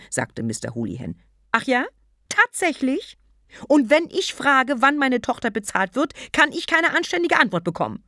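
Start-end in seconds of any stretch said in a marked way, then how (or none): 1.5-8.0 loud, high, fast angry mockery; furious sarcasm. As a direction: spit it back, outraged.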